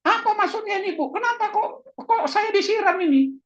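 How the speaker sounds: acting out an angry reply after being splashed; angry retort. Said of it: "Tapi kalau saudara marah".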